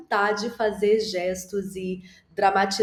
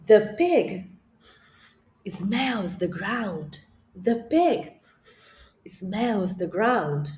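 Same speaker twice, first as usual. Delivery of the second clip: slow model sentence; much slower than usual. Shown as a demonstration. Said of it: "nós vamos falar inglês juntos".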